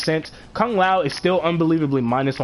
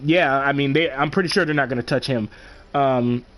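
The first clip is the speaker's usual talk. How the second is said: ordinary talk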